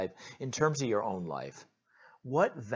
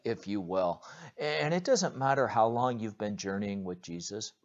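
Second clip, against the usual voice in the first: ordinary talk, nothing marked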